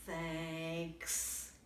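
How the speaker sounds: soft, low sad thanks. Acted. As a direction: mumble it sadly, deep. Bear's quiet sad thanks.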